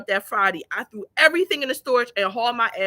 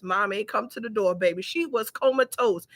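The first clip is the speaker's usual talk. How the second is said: normal talking voice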